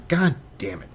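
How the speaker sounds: normal talking voice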